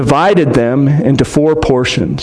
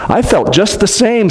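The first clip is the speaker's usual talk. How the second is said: breathless; 6 semitones higher than usual. A narrator's reading. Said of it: "said Edmund in a breathless voice".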